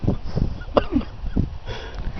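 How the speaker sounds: airy high-pitched laugh; real laughter at the memory; high breathy laugh. Real, not acted. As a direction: let a wheezy laugh escape. Laughing at own story.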